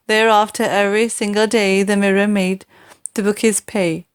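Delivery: ordinary delivery, nothing marked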